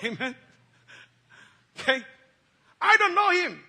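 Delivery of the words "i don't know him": higher, loud, shouted denial. Acted. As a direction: fling it out in protest. Peter's denial repeated.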